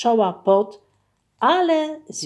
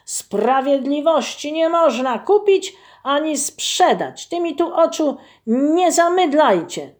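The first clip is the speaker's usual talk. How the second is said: loud, higher indignant roar; bear's roar; 7 semitones higher, 5 dB louder than usual. Acted. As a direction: roar it out, furious at the bribe. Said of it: "ryknął". (ryk oburzonego niedźwiedzia)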